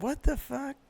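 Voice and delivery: thin, high "what the fuck" in imitation; mock disbelief. Friend squinting at the new shirt, mock disbelief. Voicing Mike's reaction to the shirt.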